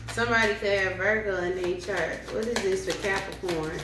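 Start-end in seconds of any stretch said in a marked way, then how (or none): none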